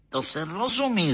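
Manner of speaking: high-pitched exclamation without added volume; exclaiming. A narrator's reading. Doctor assuring his listener it's obvious, exclaiming. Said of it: "zvolal doktor Mejzlík".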